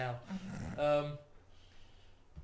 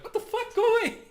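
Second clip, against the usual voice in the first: high, loud startled yell; startled shout; 10 dB louder, faster than usual. Acted. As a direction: shout them away in alarm.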